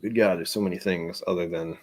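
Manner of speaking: ordinary talk, nothing marked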